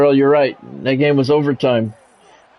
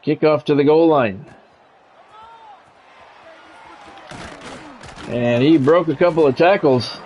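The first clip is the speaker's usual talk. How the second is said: brisk broadcast call of the kickoff; play-by-play. Acted. Gamer announcing the kickoff return, play-by-play. Live game commentary.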